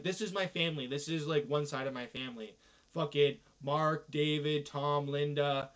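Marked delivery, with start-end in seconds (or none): none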